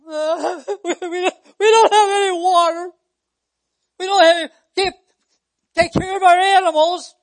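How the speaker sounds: loud, high hammed-up wailing complaint; mock crying. Preacher acting Israelites complaining to Moses, mock crying. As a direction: wail and whine like an aggrieved crowd, exaggerated. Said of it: "The people started crying".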